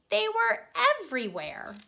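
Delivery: plain reading voice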